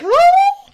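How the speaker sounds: very high, loud mock-panic squeal; exaggerated anxiety shriek; dog-whistle squeal. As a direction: let out a piercing high "oh". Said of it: "my voice is so high that".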